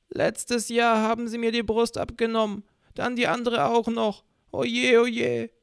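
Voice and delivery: put-on high whiny old woman; old lady voice. A narrator's reading. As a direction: play the self-pitying old lady, high-pitched.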